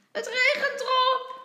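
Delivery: put-on happy child's cry, high and loud; blij roepend. A narrator's reading. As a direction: call it out high with delight. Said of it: "roept kleine Jan blij".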